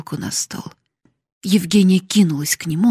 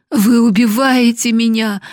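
higher, louder protest; anguished cry; 5 semitones higher, 6 dB louder than usual. A narrator's reading. Sick wife accusing her husband, crying out. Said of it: "закричала госпожа Гранде".

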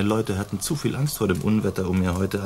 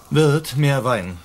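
calling out; 5 semitones higher, 5 dB louder, much slower than usual. Acted. Calling the innkeeper across tavern.

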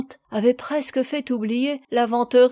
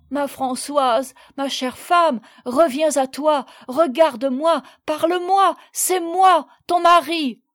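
building to a high, loud cry; implore, climbing higher with each phrase; desperate pleading; 5 semitones higher, 6 dB louder than usual. A narrator's reading. Husband calling his unconscious wife back, desperate.

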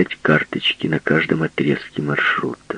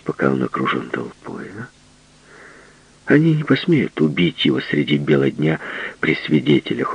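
plain reading voice

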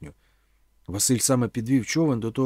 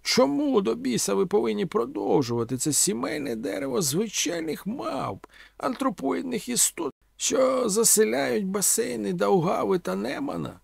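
high-pitched, theatrical oratory; declaiming orator Omela; 9 semitones higher than usual. A narrator's reading. Omela haranguing young listeners with grand questions, declaiming.

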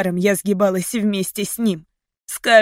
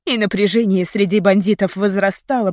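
usual speaking voice

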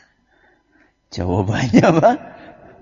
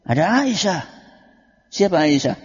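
ordinary talk, nothing marked

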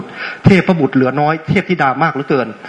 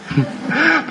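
high laugh; 12 semitones higher, 5 dB quieter than usual. Real, not acted.

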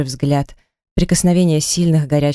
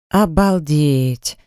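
ordinary delivery, nothing marked

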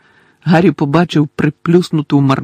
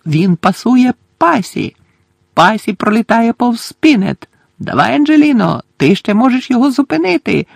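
higher play-by-play, climbing in urgency; commentator shouting; 8 semitones higher, 4 dB louder than usual. Acted. Commentator cheers on his chaser to stop him, shouting. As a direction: follow the pass, then urge her on. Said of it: "кричав Лі".

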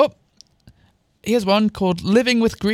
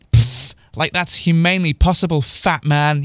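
plain reading voice

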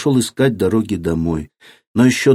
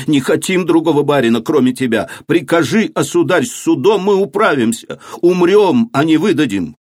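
higher, pleading crowd shouts; crowd shouting; 6 semitones higher than usual. A narrator's reading. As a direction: cry out as a loyal crowd. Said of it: "кричали они".

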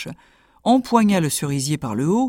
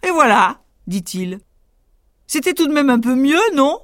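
higher, louder boastful tailor; 8 semitones higher, 4 dB louder than usual. Acted. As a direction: crow over the giant.

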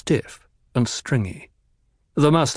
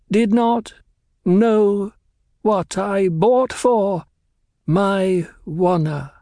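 very slow, higher, each word set apart by pauses; dying man spacing every word with care; oddly measured cadence; 10 semitones higher, slower than usual. Acted. Stabbed doctor defies the Baron, higher, very slow and measured. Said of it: "his voice came in oddly measured cadence".

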